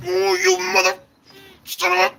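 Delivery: high, strained mock rage. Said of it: "He comes up to me and he's like".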